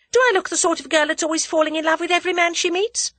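put-on high, fast, offended girl; indignant young woman. A narrator's reading. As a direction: rattle it off in mock outrage. Tuppence voiced indignantly.

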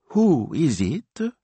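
very high, slow question; bewildered Italian lady; baffled signora. A narrator's reading. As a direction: draw it out, high and puzzled. Baffled sister asks who.

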